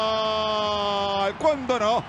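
elongated ecstatic goal call; goal scream. Real, not acted. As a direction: stretch out the goal shout. Goal call after penalty.

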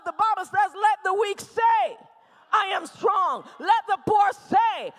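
shouted, rousing declarations; rally the crowd to fight; full-force preaching. Real, not acted. Preacher urging people of God to claim their miracle, shouting. Rallies the congregation.

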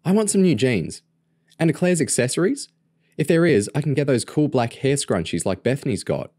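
high-pitched, keen little sister; eager. A narrator's reading. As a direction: gush about what you want, voice up high. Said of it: "Lauren asked eagerly".

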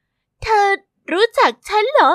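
a hint of a wary young princess; Rapunzel. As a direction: ask the stranger who she is. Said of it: "ราพันเซล".

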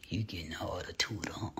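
unvoiced teasing whisper; low gossipy whisper. Real, not acted. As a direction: hush it like gossip. Teasing hushed aside.

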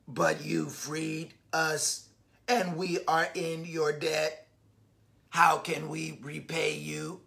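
low, slow, soft robot leader. Acted. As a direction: speak as a grateful robot leader. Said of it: "the mighty robot leader says".

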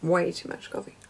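low, soft tired murmur; quiet retake. Shown as a demonstration. Vlogger retakes her intro, murmuring softly. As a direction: say it like you are drained. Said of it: "try again".

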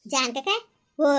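normal talking voice

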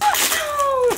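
gasp. Real, not acted. Opening heavy box.

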